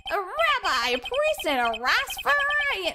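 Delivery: high-pitched clown comedian; eager clown doing stand-up; corny joking clown. Acted. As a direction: pitch it up and sell a bad joke. Clown starts a joke in court.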